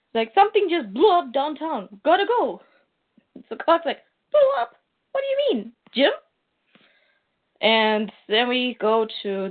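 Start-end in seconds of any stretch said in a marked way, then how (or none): 0.3-2.6 mildly alarmed, higher-pitched text message; urgent news. Acted. Friend warning Clark of an explosion, lightly acted urgency. 4.3-6.1 very high-pitched, incredulous questioning; startled disbelief. Acted. Clark texting back to his friend about the blast, startled disbelief. Clark reacts to explosion news.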